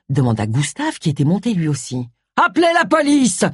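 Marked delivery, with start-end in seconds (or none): 2.4-3.4 high-pitched cry for help; shouted alarm. A narrator's reading. Charles shouts for someone to call the police, high alarmed cry. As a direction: call out urgently, voice jumping up. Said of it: "cria Charles".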